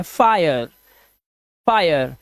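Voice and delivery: "फायर" said carefully as a model word; pronunciation demo. Shown as a demonstration. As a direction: model "फायर" with a clear "आय" glide. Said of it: "इसी तरह से ये है".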